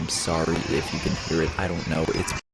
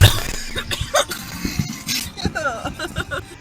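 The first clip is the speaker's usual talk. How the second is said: high, long laughing fit; laughter; 4 dB quieter than usual. Real, not acted. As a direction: burst out laughing, high and breathless. Laughing at a sneeze clip.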